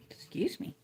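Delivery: hiccuping mid-sentence; hiccups. Real, not acted. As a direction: talk through a hiccup. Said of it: "Hiccuping and talking don't mix well".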